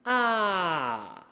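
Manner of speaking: drawn-out falling "Ah". Shown as a demonstration. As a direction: slide "Ah" down to the bottom of the voice. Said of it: "at the bottom of our vocal register".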